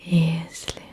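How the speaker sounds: usual speaking voice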